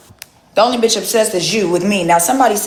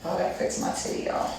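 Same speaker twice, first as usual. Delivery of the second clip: whispered aside; 2 semitones lower, 15 dB quieter than usual. Real, not acted. Quick aside.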